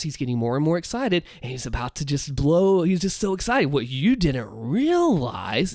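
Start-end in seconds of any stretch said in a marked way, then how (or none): 3.7-5.7 slow teasing tone hinting at a reveal; ominous build. Shown as a demonstration. Creator demonstrating the villain, slow ominous teasing build. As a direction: draw it out, something's coming. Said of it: "that tone implies something's coming".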